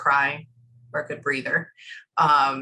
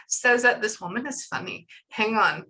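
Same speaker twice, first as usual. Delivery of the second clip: normal talking voice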